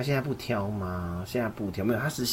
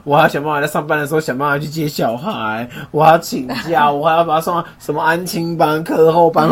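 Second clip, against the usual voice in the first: tired parent complaining; 5 semitones higher, 10 dB louder than usual. Retold or imitated. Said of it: "爸妈说：".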